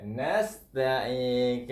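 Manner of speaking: nasta'in slowed with a crisp s; clear sin. Shown as a demonstration. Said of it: "Kena jelas hurufnya". (sebut sin dalam nasta'in dengan jelas)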